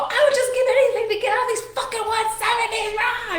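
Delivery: yelling at Julie over dinner; frustrated scream. Retold or imitated. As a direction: shriek it at your friend. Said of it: "but I just screamed at her".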